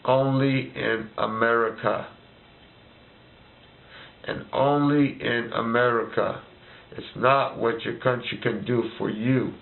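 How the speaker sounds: slow, measured, leaning hard on "only"; slow solemn pronouncement. Shown as a demonstration. Leaving listeners a parting motto, slow and weighty. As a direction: hand down a slogan slowly, stressing "only". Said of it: "Remember this".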